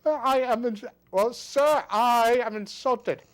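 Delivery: high, loud, slow huffy voice; hammed-up wounded dignity; mock insulted. Mock offense at the sarcasm.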